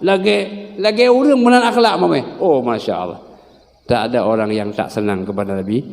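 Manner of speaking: ordinary talk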